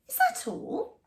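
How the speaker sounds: a hint of a snooty mouse; disappointed mouse.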